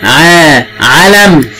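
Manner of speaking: ع held long, then علم drawn out; teacher drawing out ع before علم; very slow sounding out. Shown as a demonstration. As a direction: sound out ع علم very slowly for a child. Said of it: "teacher sounds out ع علم".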